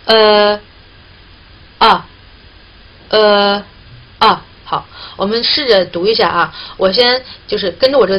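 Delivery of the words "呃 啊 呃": 呃 then 啊 then 呃, each held and a bit louder; vowel demo. Shown as a demonstration. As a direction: alternate 呃 and 啊 clearly.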